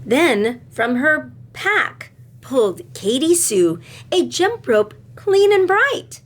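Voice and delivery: usual speaking voice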